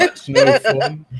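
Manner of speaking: laughing protest. Real, not acted. Player laughs while protesting.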